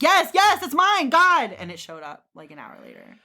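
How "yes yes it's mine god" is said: high, loud, fed-up shout; shrieking with exasperation at the Russian caller; exasperated yell. Retold or imitated. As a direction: yell it out, desperate to get the bag back. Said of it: "I'm like".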